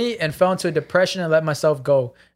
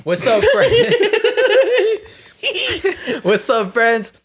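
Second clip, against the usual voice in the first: much higher squeaky laughing greeting; 5 dB louder, slower than usual. Pretend. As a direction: pitch way up, giggling through it. Squeaky greeting before reading letter.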